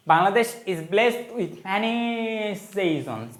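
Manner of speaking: drawn-out classroom reading, word by word; slow dictation. Shown as a demonstration. Teacher reading the exercise sentence aloud, slow dictation. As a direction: spell the sentence out slowly.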